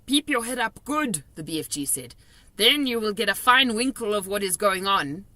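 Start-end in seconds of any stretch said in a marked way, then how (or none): 0.0-1.2 higher, louder friendly giant voice; kindly giant, raised voice; BFG giant voice. A narrator's reading. 2.6-5.3 higher, louder friendly giant voice; kindly giant, raised voice; BFG giant voice. A narrator's reading.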